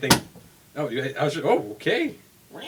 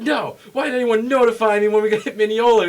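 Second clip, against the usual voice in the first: higher and louder, mock outraged protest; indignant complaint; 9 semitones higher, 9 dB louder than usual. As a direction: imitate the passenger snapping at the conductor. Angry passenger arguing with conductor.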